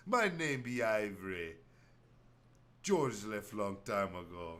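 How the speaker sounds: a hint of Jamaican, denying his past; mock Jamaican accent. Castaway disowning his old self in mock Jamaican. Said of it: "crazy Jamaican accent".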